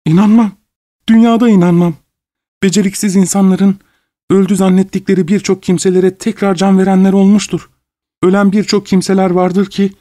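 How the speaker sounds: high-pitched desperate woman's plea; frantic pleading. A narrator's reading. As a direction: implore the doctor, refusing to believe. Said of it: "yalvarır gibi birleştirerek çılgın bir heyecanla". (çılgınca, tiz sesle yalvararak)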